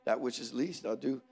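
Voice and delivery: very soft, low, subdued musing; tearful resignation. Retold or imitated. Passed-over man resolving to stay faithful, tearful resignation. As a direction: talk quietly to yourself, resigned and hurt. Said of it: "My eyes got real watery".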